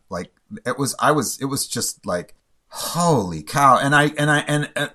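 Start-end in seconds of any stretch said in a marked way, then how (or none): 2.7-4.9 amazed. Retold or imitated. Shock at the news.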